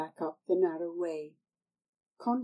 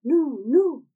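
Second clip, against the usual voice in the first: higher and louder, anxious refusal; frightened girl; 8 semitones higher, 11 dB louder than usual. Acted. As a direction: pull back in alarm. Tanner refuses in horror.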